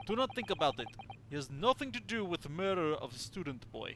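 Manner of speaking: put-on dismissive Russian sailor accent. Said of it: "sorry to literally anybody who actually has a Russian accent".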